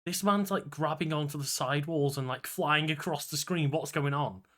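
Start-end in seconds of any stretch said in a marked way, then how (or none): none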